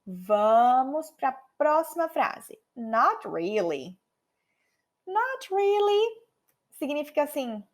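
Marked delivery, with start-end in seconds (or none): 2.7-6.3 Not really drawn out very slowly; not really intonation. Shown as a demonstration. Teacher shows the tone of a polite refusal: slow drawn-out Not really. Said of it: "a entonação do not really".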